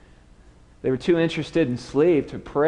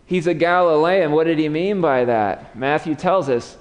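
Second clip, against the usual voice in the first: ordinary talk, nothing marked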